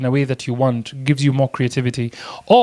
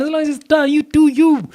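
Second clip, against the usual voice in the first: high-pitched, insistent repeat; emphatic reassurance; 11 semitones higher than usual. Real, not acted. Teacher telling learners anything goes, high-pitched and emphatic. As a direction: drive it home way up high.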